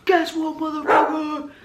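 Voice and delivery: high, put-on triumphant voice; taunting retort. Answering those who doubted her, high gloating taunt. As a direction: throw it back at them, high and gloating.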